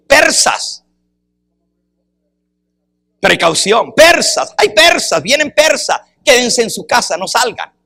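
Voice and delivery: urgent warning shout. Shown as a demonstration. Preacher acting an alarm to the Persian people, urgent shout. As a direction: shout it like a town crier warning of danger. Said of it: "Si yo digo".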